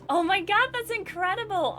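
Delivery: high, loud gush; squealing amazement, pitch way up; awed excitement. Acted. Emily hears of the magazine job, high excited gush. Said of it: "Emily's jaw drops".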